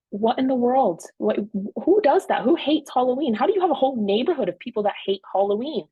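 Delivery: high-pitched mock outrage; retold anger. Wife retells ranting at husband about Halloween haters, outraged. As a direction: rant in disbelief, pitch jumping up. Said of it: "I'm getting angry".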